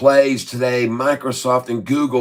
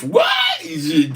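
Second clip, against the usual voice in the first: shrieked high in disbelief; surprised shout. Real, not acted. Shouting at the chat, shocked by the price.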